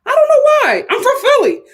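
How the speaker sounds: loud. Shown as a demonstration. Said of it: "repeated louder".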